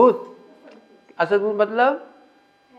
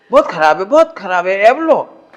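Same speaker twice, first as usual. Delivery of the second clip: a loud, exasperated mother scolding; a hammed-up mother telling off her child; mock scolding mother; 8 dB louder than usual.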